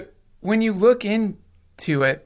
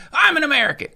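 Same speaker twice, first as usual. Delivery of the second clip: pitched-up mock proud citizen; smug patriot; 11 semitones higher, 4 dB louder, faster than usual. Mocking people who claim a national identity, in a high proud voice. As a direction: parody someone proudly declaring their nationality. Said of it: "some sense of like".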